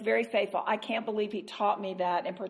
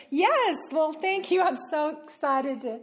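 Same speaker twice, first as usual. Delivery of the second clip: high, slow fake politeness with a laugh; mock cheerful; 6 semitones higher than usual. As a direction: sound thrilled but mean the opposite. Sarcastic answer to committee news.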